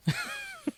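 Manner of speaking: a real very high shriek of laughter. Real, not acted. Reading fanfic notes to a friend, a high shrieking laugh. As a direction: shriek with delight at the note.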